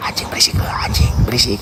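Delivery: hushed whisper at the dog. Real, not acted. To the noisy neighbour dog, whispering.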